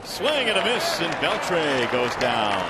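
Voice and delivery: loud, rising call, then drawn out slowly; announcer bursting out at the big out; excited strikeout call. Real, not acted. Strikeout call in live broadcast.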